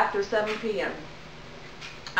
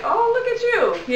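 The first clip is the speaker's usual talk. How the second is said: teasing husband; 13 semitones higher, faster than usual. Retold or imitated. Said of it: "Now he's like".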